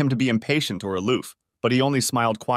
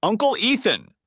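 put-on high gleeful shout of a little girl; children screaming a greeting to their uncle; excited scream; 11 semitones higher than usual. A narrator's reading. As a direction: call out his name with joy, much higher.

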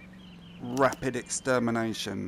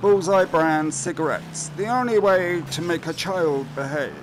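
mock ad read; 4 semitones higher, 5 dB louder than usual. Reading ad in game.

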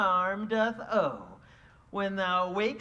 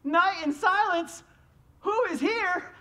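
impish sprite voice; 8 semitones higher than usual. Acted. Puck searching in the dark.

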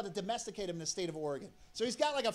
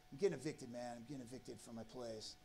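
mildly mock-upset, sinking softer to the end; upset friend; 11 semitones lower, 11 dB quieter than usual. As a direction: imitate a dejected friend, low and quiet. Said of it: "he's upset".

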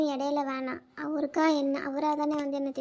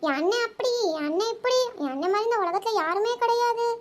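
mocking imitation; 5 semitones higher than usual. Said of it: "தங்கச்சி அப்படியே உருகு உருகுன்னு உருகுலாளே".

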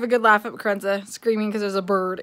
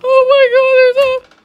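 high, loud drawn-out wail; panicked quote; 12 dB louder, much slower than usual. Retold or imitated. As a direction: re-enact the panic, high and slow. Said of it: "so I'm sitting here going".

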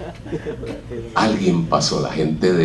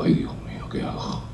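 unvoiced, breathless whisper of worry; a hushed little "ay, Dios mío" to yourself; whispered panic; 11 dB quieter than usual. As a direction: whisper it like you're stuck and scared. Panicked thought when canvas left behind.

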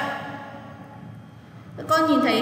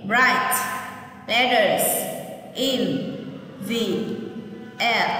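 word by word; 3 semitones lower, slower than usual. Shown as a demonstration. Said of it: "Nghe cô đọc lại lần nữa nhé".

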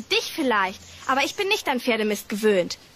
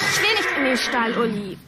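usual speaking voice

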